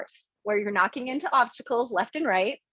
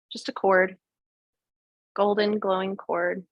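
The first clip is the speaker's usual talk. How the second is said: calm, unhurried meditation guide; guided meditation. Acted. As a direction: talk them gently into the visualization. Leads a guided visualization.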